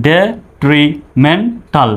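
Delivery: syllable by syllable, detrimental drawn out; slow broken-up demo. Shown as a demonstration. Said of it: "ভেঙে ভেঙে".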